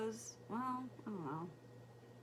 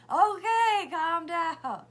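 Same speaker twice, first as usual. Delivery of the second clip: raised voice, high and forceful; retold loud placating; 8 semitones higher, 14 dB louder, much slower than usual. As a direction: loudly tell an upset kid to settle. Retold mom answers son's outburst.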